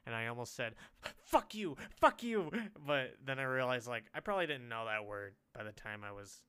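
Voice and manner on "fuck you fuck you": high, loud mock outburst; angry kid. Kid snapping at teasing cousin, high loud mock anger. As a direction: lash out like a mad little kid. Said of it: "how I would say things as a kid".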